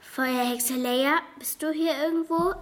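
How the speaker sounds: plain reading voice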